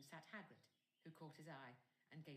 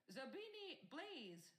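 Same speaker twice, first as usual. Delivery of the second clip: calling names; 11 semitones higher, 4 dB louder, much slower than usual. Acted.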